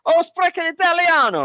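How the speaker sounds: very high, loud mock-Italian cry. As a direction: exclaim like a hammed-up, excited Italian. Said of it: "most stereotypical Italian person".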